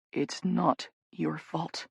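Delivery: slow quiet reassurance; murmured. Acted. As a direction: say it gently, barely aloud. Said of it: "he murmurs".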